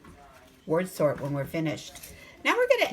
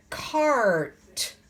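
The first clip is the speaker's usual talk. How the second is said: ordinary delivery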